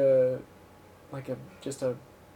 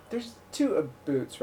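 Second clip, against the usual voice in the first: ordinary talk, nothing marked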